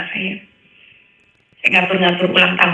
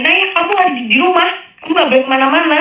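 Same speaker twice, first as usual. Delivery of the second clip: put-on much higher, raised call to a child in another room; mother calling across the house, high and forceful; retold yell; 9 semitones higher than usual. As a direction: call out firmly so the daughter hears. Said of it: "dengar saya teriak begitu".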